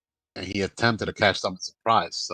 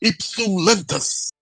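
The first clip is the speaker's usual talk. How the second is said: high, slow, drawn-out casting cry; screamed spell incantation; 11 semitones higher, slower than usual. Acted. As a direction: scream the spell, stretching each word. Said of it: "then he screams out, like, loud".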